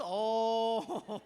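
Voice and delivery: usual speaking voice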